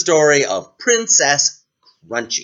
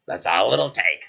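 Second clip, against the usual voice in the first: lower-pitched frog voice; deep creature voice; talking frog; 6 semitones lower than usual. Acted. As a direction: reassure as a frog. Frog answers princess.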